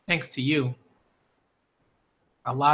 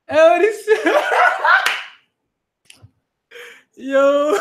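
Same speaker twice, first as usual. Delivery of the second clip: real laughter; 4 dB louder, much slower than usual. Real, not acted. Laughing hard at the panel.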